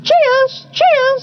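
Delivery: high cockatoo calls. A narrator's reading. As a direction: call it out like a cockatoo.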